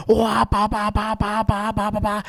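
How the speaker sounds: high, revving engine noises with the mouth; imitated engine revving. Telling friends how he gunned the Jeep, imitated engine revs. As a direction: make the motor roar with your voice. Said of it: "just giving it the beans".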